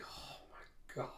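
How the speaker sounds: very soft, slow whisper; breath-only awed murmur; hushed shock. Acted. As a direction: whisper it slowly in disbelief. Narrator reacts in awe to scene.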